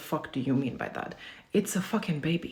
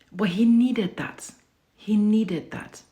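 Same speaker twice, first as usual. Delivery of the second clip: louder, higher, slow and firm; emphatic insistence; 5 semitones higher, 8 dB louder, slower than usual. Real, not acted. Defending carrying her son, emphatic and slow. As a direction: drive the point home.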